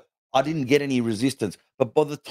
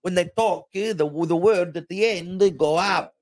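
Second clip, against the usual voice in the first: higher-pitched, heavy Italian-accented English; 4 semitones higher than usual. Shown as a demonstration. Comedian explains how Italians end words, Italian accent. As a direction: put on an Italian accent, adding vowels to word endings. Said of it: "that's a real Italian English accent".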